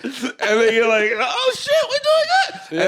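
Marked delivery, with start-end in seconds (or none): 1.1-2.9 much higher, excited yelling; excited shout. Retold or imitated. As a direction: burst out in joy. Acted fan surprised by winning.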